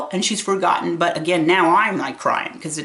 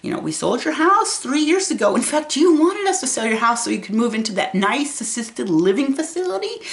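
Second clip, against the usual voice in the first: higher, bright reassuring voice to mom; lifted, patient voice for an elderly mother; quoting herself to mom; 8 semitones higher than usual. Retold or imitated. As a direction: explain gently to a confused parent. Retelling what she told her mom.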